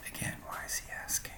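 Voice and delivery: hushed, quick whisper to the listener; whispered aside. Acted. Narrator aside.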